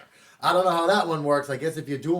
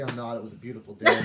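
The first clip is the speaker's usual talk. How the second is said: ordinary talk, nothing marked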